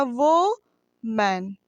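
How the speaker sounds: वो मैन broken in two, वो drawn out and rising, then a pause before मैन; split into syllables. Shown as a demonstration. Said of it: "एक ये अलग है, एक ये अलग है सिलेबल". (वो मैन को दो सिलेबल में तोड़कर, वो को खींचकर बोलें)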